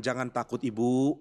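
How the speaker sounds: low, soft, consoling voice; hushed pastoral comfort, pitched low and unforced; comforting reassurance. Acted. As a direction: gently soothe a worried mother, voice dropped low. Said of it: "Ambrosius menghibur dia dengan mengatakan".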